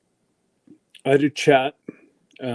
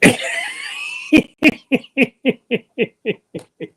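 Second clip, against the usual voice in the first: real shrieking laugh; big laugh. Real, not acted. Laughs at dishwasher joke.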